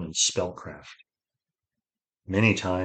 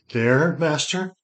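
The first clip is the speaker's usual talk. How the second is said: high, slow, frightened question; terror; 9 semitones higher, 5 dB louder, slower than usual. A narrator's reading. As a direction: ask it with fear catching in the voice. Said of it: "Ged said with terror in his voice".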